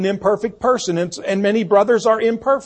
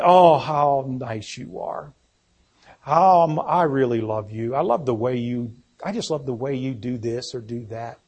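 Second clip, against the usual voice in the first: soft, low, syrupy gushing; mock-sweet flattery, laid on softly; sappy, syrupy; 5 semitones lower, 5 dB quieter than usual. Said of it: "it's so sappy and syrupy".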